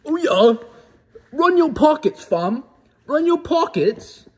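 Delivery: high, loud mock London roadman slang voice; mock British roadman. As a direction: ham up a London roadman threatening a mugging. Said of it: "You'd be like".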